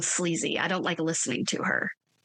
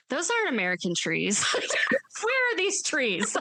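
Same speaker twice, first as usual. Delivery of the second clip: incredulous; 5 semitones higher than usual. Retold or imitated. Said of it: "I was like".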